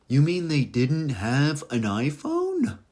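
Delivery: high, drawn-out incredulous question; hammed-up incredulity, stretched out slowly; mock disbelief. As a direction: scoff in exaggerated astonishment at the past. Mocks people judging the past.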